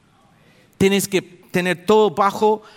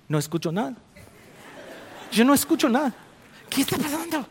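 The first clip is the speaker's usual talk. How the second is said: lightly voiced puzzled pastor, rising high; a hint of a bewildered older man; confused pastor; 8 semitones higher, 3 dB quieter, faster than usual. Preacher imitates the pastor who hears nothing. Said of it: "el pastor estaba así".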